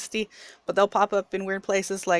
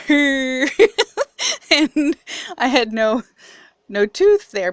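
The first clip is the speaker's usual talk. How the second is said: quoting herself through real giggles, higher and louder; laughing; 6 semitones higher, 9 dB louder, slower than usual. Real, not acted. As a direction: laugh while getting the words out. Telling mom about broken tooth.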